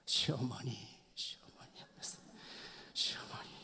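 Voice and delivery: hushed, near-whispered muttering while acting out venting at laundry; muttered venting. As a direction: vent under your breath, very softly, fading into a whisper. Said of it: "다듬이질을 하면서 푸는 거예요". (분을 삭이며 속삭이듯 중얼거림)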